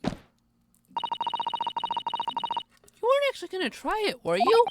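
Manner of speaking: put-on bright high polite young woman. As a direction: lift the voice way up, sweet and proper. Voices Susato teasing.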